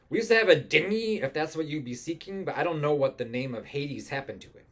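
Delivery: high-pitched, loud salty captain; ship's captain. Acted. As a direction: play an excitable sea captain. Captain answers in character.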